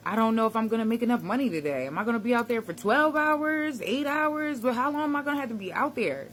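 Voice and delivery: put-on retelling his old dread in a rush; worried inner voice. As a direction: race through the money fears. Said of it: "It was kinda scary to me".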